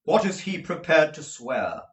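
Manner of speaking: demanding lawyer. Acted. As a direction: press for an answer. Jaggers questioning.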